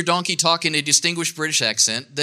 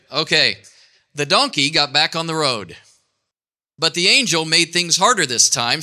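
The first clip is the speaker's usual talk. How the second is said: ordinary talk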